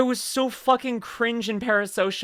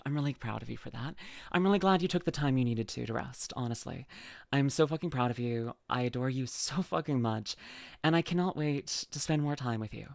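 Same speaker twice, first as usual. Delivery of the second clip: sincere; 6 semitones lower, 9 dB quieter than usual. Real, not acted. Sincere praise to friend.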